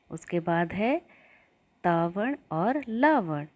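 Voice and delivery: usual speaking voice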